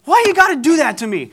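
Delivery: high, loud wounded complaint; indignant protest. Retold or imitated. Husband to his wife after her threat, indignant. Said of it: "And he says".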